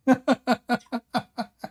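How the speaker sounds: high-pitched, tailing-off giggles; high helpless laughter. Real, not acted. Host at the opponent's exit, helpless laughter. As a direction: laugh until the breath runs out.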